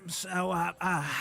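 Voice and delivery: ordinary delivery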